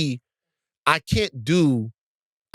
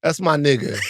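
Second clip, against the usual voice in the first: ordinary talk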